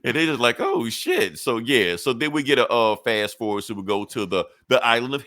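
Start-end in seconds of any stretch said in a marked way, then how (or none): 0.6-1.4 high-pitched retold shock; mock amazement. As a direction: play the demons' stunned reaction, high. Retold reaction of stunned demons.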